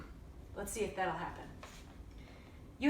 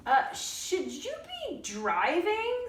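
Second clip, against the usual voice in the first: doubtful lady; 9 semitones higher, much slower than usual. A narrator's reading. Lady questioning child driver.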